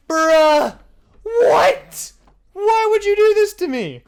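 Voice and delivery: very high, loud wailing outrage; mock wail of a betrayed friend, way over the top; hammed-up betrayal. Student to friend who ratted him out, hammed-up outrage.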